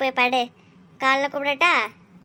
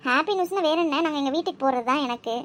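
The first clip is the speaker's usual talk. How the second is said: ordinary talk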